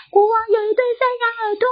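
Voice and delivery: high, fast sung-out shout. Acted. Barber shouting his secret into the hole, sing-song. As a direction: belt it out with relief. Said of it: "忍不住的大喊说".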